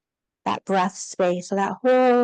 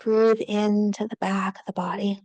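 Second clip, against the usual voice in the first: whispering. Real, not acted. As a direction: breathe the words out in a whisper. Whispered breathing cue in yoga.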